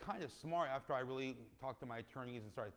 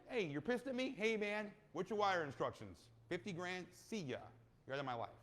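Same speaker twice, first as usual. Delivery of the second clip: dismissive mock brush-off; 6 semitones higher, 4 dB louder than usual. Voicing himself paying off investor.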